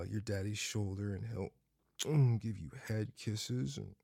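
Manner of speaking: ordinary talk, nothing marked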